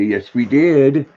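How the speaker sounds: slow, high sing-song coo to the dog. Real, not acted. Owner coos to her dog Luna after their walk, high and slow. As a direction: coo it slowly like praising a puppy.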